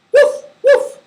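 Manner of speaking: high, loud puppy woofs; two short, high-pitched barks; dog bark. Shown as a demonstration. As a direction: yap like a small dog. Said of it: "will make the sound".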